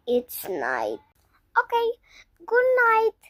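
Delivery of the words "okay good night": high sweet goodnight. Acted. Bedtime goodbye acted.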